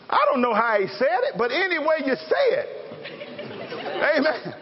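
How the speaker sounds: ordinary talk, nothing marked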